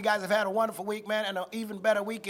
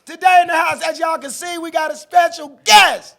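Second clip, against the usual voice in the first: loud, high hype host intro; hype announcer; 8 semitones higher, 13 dB louder than usual. Acted. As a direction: shout it like an MC. Hosts open the podcast.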